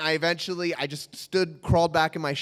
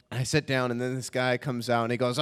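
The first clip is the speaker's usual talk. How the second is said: ordinary delivery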